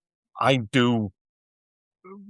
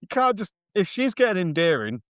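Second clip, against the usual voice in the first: high-pitched mock objection; playful protest; 7 semitones higher than usual. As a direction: insist it's not a compliment, voice way up. Protesting the compliment.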